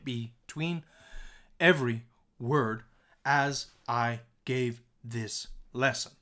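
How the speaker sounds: halting, one word at a time; stopping between words. Shown as a demonstration. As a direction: chop the sentence with a pause after every word. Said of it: "We don't talk like that".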